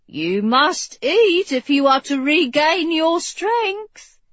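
high, slow insistent nurse voice; coaxing nurse. A narrator's reading. Nurse urging the sick king to eat, high coaxing. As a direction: urge slowly, high-pitched and emphatic.

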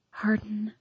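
soft, breathless whisper of his name. A narrator's reading. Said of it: "I whisper".

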